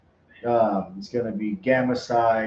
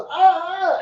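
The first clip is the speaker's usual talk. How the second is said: high yelp as she falls; a sudden high yelp mid-fall; falling cry; 4 dB louder than usual. Retold or imitated. Old lady falls mid-spin.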